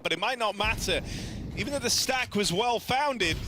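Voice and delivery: rising, urgent caster call; play-by-play commentary. Acted. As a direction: call the action as it unfolds.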